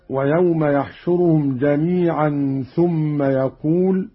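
يحشرهم and يقول begun with ya; ya reading. Shown as a demonstration. Teacher quotes the verse before the variant, يحشرهم with ya. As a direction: say يحشرهم as yahshuruhum, يقول as yaqool. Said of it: "بنون العظمة".

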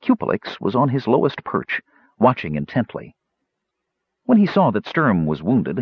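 usual speaking voice

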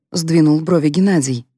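ordinary delivery

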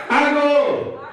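high, ringing call-and-response cue; rousing high summons. Shown as a demonstration. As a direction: call out high to the whole room. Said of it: "you're gonna say".

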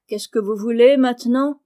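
put-on gruff elderly lady snapping at visitors; lightly voiced crotchety old woman; stern old aunt. A narrator's reading. Aunt March voiced in story.

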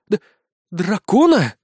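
stammered, high startled question; horrified. Acted. Blonde learns of the dragon, stammering in horror. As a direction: stumble on the first sound in dread. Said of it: "Безос с ужасом посмотрела".

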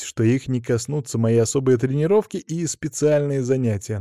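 plain reading voice

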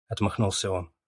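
ordinary delivery

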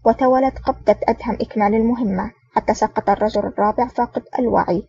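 plain reading voice

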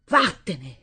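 a loud, cutting order to leave; shouted. A narrator's reading. Stepmother shouting at Assunta to get out. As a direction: throw her out with one word. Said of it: "e le gridò".

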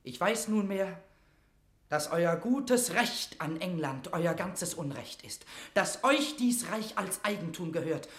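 fervent, ringing avowal; passionate conviction. Acted. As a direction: proclaim her right with fire. Mortimer declares her right.

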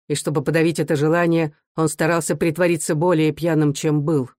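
ordinary delivery, nothing marked

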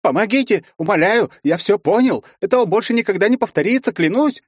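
high, loud, fast begging; panicked pleading. Acted. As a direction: beg for your life in panic. Призрак в панике молит. (паническая мольба)

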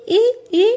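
very high squeaky monkey call; monkey squeak. Acted. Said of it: "Yo hago el sonido i". (chillido agudo de mono)

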